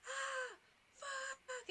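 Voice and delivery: high, pained yelp of a curse; retold scream. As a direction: yelp it like a hot shell hit your skin. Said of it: "I was screaming".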